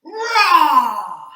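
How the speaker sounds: huge loud roaring Rah; big ferocious roar; lion roar. Shown as a demonstration. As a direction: roar like the king of the jungle. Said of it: "just for luck".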